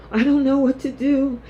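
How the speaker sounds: high, helpless voice playing the frazzled girl; hammed-up high-pitched fretting; mock panicky Hope. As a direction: whine it out like a girl who's lost herself.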